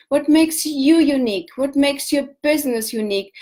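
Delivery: ordinary talk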